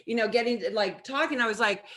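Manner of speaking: ordinary talk, nothing marked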